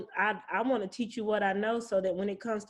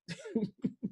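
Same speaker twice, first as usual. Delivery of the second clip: short laugh; 9 semitones higher, 5 dB quieter than usual. Real, not acted. Laugh after tenant joke.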